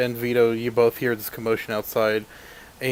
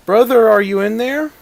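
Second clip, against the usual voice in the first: raised voice, pitched high through a door; calling out; 10 semitones higher, 8 dB louder than usual. Acted. Someone at the door calls in for a brother, calling out. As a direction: call loudly for a brother inside.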